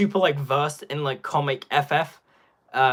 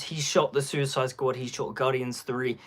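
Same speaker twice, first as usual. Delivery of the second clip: usual speaking voice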